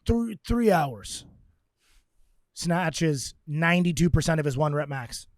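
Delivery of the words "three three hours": higher, very slow Russian accent, imitated. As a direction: drag out the numbers in a Russian accent. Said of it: "says in his Russian accent".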